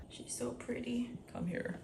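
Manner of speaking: whispered, breathless with delight; soft whisper. Real, not acted. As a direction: whisper it so you don't scare her. Cooing over something cute.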